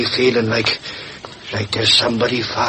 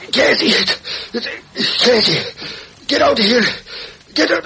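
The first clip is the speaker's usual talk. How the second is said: desperate warning; 12 semitones higher than usual. Acted. Gassed reporter shouting to warn Casey on the sidewalk, strained and desperate. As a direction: call out to warn her while choking.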